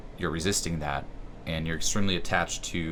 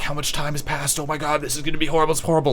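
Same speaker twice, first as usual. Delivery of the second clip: higher, fast, fretful mock panic; 9 semitones higher, faster than usual. Imitating a listener panicking in the leg stretch, fast mock panic. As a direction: rattle it off like someone panicking in the stretch. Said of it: "freaking out, um, getting obsessed".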